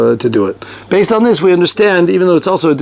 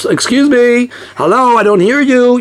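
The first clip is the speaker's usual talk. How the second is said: mock complaint; 7 semitones higher than usual. Rabbi playing a congregant who can't hear the reader, mock-exasperated. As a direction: call out like a listener straining to hear.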